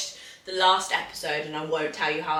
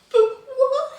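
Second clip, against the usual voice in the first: mock distressed disbelief; 7 dB louder than usual. Retelling crying at a sad film.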